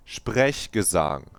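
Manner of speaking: Sprechgesang repeated slowly with German ch and rolled r; German pronunciation. Shown as a demonstration. Repeating the word to teach it. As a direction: give Sprechgesang its true German sound. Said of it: "That's how you say it".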